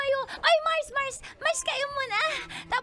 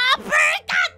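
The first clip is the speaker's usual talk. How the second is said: loud, higher shout with the blow; punch call; 8 semitones higher, 13 dB louder, slower than usual. Acted. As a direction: shout the move as you strike. Calls out punches while hitting. (pasigaw habang sumusuntok)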